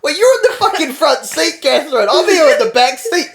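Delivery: high, loud retold complaint; indignant protest. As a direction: raise your voice in mock outrage at the front seat. Protests to Catherine from back seat.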